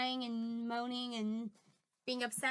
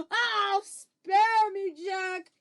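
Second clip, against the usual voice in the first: loud, high begging cry; yelled plea; 8 semitones higher, 13 dB louder, much slower than usual. Acted. Giant begs Jack to spare him, yelling. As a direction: yell for mercy in terror. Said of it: "he yelled".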